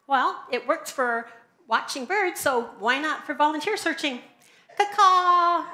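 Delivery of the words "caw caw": loud bird call; chirping bird person. Pretend. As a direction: caw like a crow. Bird calls for volunteers.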